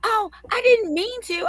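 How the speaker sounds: high, whiny mock apology; mock teacher excuse. Mom mocks the old teacher's high excuse. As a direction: squeak out a lame excuse like the teacher. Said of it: "She goes".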